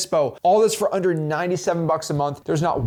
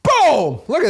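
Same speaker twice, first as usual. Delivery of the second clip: high, loud burst of triumph; triumphant exclamation; 9 semitones higher, 6 dB louder, slower than usual. Real, not acted. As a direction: punch it out high and loud, sliding down. Thrilled that the demo call worked.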